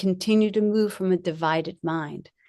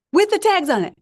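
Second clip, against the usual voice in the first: much higher and louder repeat of "with the tags on it"; amazed repeat, pitched way up and louder; excited emphasis; 11 semitones higher, 5 dB louder than usual. Real, not acted. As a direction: hit "with the tags on it" again in amazed disbelief, far higher and louder.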